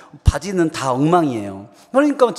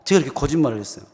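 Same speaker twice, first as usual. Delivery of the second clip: normal talking voice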